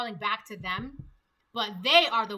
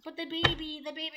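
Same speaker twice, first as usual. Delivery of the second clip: higher-pitched mock pleading; needy plea; 5 semitones higher than usual. She tries to get his attention, mock needy. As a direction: play her bidding for his attention in a higher voice. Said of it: "She could be like".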